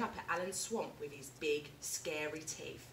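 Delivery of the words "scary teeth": plain reading voice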